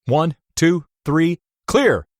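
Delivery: doctor shocking the patient, loud; doctor's count. A narrator's reading. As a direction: count down and call clear. Doctor defibrillating.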